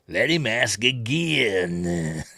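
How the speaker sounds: very drawn-out exaggerated American accent. Showing how he says it, hammed-up American accent. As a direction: overdo the American accent, extremely slowly. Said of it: "That's how he says it".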